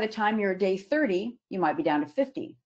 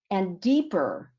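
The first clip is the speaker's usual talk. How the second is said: deeper drawn out slowly, set apart; slow down and lean into deeper; emphasis on deeper; slower than usual. Shown as a demonstration. Speaker urging planners to reassess, slowly stressing deeper. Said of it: "I really want to emphasize the word deeper".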